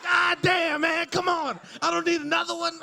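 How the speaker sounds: high, loud exasperated yell; exasperated shout. Acted. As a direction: throw up your hands and yell. Exasperated outburst at the stalker.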